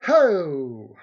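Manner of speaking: sudden booming shout; loud exclamation. Real, not acted. Streamer reacting to a picture, loud exclamation. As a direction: burst out loudly.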